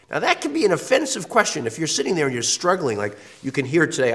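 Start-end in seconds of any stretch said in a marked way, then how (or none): none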